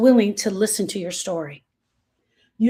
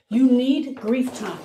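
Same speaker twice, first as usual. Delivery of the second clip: normal talking voice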